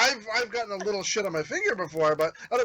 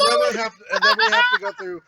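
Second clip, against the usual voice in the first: laughing; 7 dB louder, faster than usual. Real, not acted. Joking about a gas explosion.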